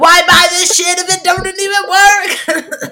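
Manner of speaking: slow, high, laughing Southern drawl; Southern accent. Pretend. Guest griping about her AirPods in a mock Southern drawl. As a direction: ham up a hillbilly Southern complaint. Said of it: "super Southern".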